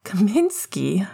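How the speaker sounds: drawn-out, disbelieving question; slow, incredulous echo. A narrator's reading. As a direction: stretch the name in disbelief. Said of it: "asked Remus incredulously".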